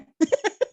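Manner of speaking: amused chuckle before repeating; quick amused laugh; short laugh. Real, not acted. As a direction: break into a brief laugh. Amused at own list.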